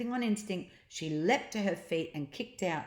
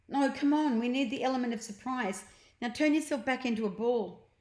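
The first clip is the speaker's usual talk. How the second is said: put-on eager boy, higher and quick; Ned, eager boy; 6 semitones higher, faster than usual. A narrator's reading. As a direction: push the plan on, excited. Ned voiced in story.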